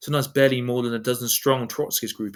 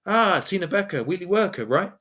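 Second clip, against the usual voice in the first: eager recognition; 7 semitones higher than usual. Retold or imitated. Greeting a comrade he recognises.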